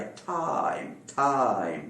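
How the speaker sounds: टाइम with aspirated T and long open "ai"; real "ai" sound. Shown as a demonstration. Teacher showing where the letter's sound lands, long open टाइम. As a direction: stretch the glide in टाइम. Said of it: "उसका असली sound यहाँ पर".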